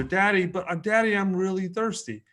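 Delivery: pleading little daughter. Retold or imitated. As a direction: mimic a small child still pestering. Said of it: "like, two minutes later".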